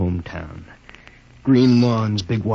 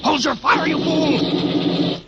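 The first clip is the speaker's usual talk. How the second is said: angry shout; much slower than usual. Acted. Captain shouting at trigger-happy soldier, furious yell. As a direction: yell at him to hold fire.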